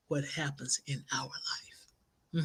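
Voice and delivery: slow hushed whisper to drive it home; slow whispered punchline. Real, not acted. Whispered emphasis on key point.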